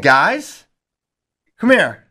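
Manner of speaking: calling out. Retold or imitated. Coach calls wrestlers into the bathroom, loud summons. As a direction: call them in, stern coach.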